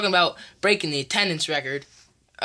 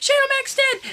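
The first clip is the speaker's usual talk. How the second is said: shocked shout. Pretend. Fan calling Shane's fall, shocked and high. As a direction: cry it out as if he really fell. Live play-by-play of the match.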